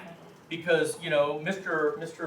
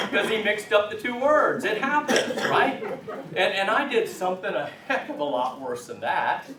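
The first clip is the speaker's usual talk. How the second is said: laughing; 6 semitones higher, 3 dB louder than usual. Real, not acted. Laughing while retelling the mistake.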